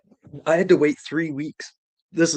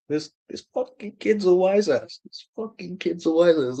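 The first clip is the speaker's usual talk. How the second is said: higher-pitched imitation of an affronted sidekick; mock indignation; 7 semitones higher than usual. Money guy complaining to inspector about the kid, mock indignation.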